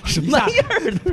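laughing disbelief. Real, not acted.

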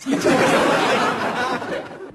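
high rising laugh; high giggle. Real, not acted. Laughs at own joke.

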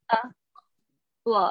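"blood" with the OO said as a short "uh"; short uh vowel. Shown as a demonstration. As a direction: give the bare vowel "uh", then "blood". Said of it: "那个O O的声音是呃". (先示范短音"呃"，再读"blood")

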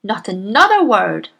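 loud cutting shout. Acted. Headmistress shouts to silence the teacher, loud. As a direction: shout to cut her off. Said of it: "shouted Miss Trunchbull".

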